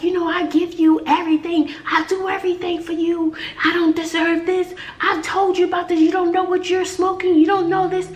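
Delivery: high-pitched retold mom tirade; imitated scolding mother. Imitating a mother going off on her daughter on live, scolding. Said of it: "the mother was going, she was like".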